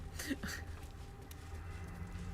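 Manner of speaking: soft airy chuckle; quiet breathy laugh. Real, not acted. Laughs at noose joke.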